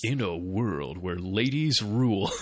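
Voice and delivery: slow, deep trailer announcer; movie trailer voice. Pretend. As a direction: boom it out like a trailer narrator. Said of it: "trailer voice guy version of this".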